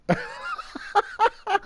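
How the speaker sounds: a shrieking burst of laughter; high squealing laugh. Real, not acted. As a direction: laugh at the violent talk, high and shrill. Laughing at violent talk.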